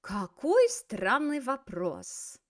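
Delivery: slow какой странный вопрос, falling at the end; exclamation intonation. Shown as a demonstration. Teacher shows the exclamation intonation, slow falling какой странный вопрос. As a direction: stretch странный in a marvelling exclamation, then fall on вопрос. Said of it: "we gotta use that intonation".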